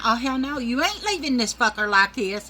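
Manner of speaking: loud, high protest; panicked outrage at the dentist; freaking out. Retold or imitated. Patient protesting to the dentist, loud and high.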